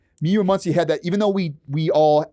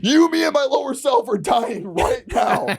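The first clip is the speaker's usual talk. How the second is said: high, hammed-up maniac voice; crazed driver; 10 semitones higher than usual. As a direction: play an unhinged driver threatening everyone. Acted crazed driver threatening passenger.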